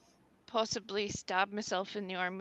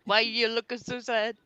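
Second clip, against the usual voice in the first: normal talking voice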